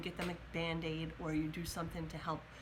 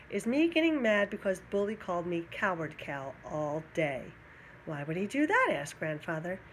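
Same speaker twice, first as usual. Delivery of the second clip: put-on reading a story to children. A narrator's reading. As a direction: read it out to a class of kids, animated. Reads a picture book aloud.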